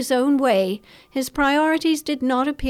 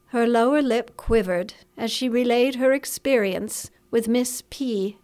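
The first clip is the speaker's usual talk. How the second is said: ordinary delivery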